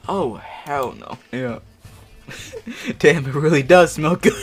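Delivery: loud, laughing character line with a giggle through it; giggly sniffing. Acted. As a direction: gush over the smell, laughing. Tootie sniffing the hoodie, fic line.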